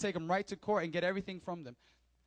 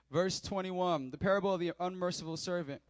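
ordinary delivery

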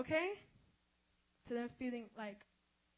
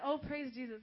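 usual speaking voice

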